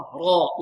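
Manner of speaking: را with a full, heavy r. Shown as a demonstration. Teacher shows the open-vowel case, heavy emphatic را. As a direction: thicken the r in را. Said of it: "مفخمة".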